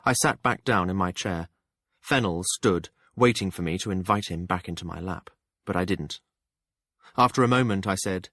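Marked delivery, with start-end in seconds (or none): none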